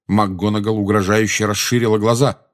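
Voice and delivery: usual speaking voice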